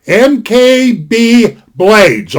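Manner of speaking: "M K B Blades" slowly, letter by letter; slow spelled out. Shown as a demonstration. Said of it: "all together now".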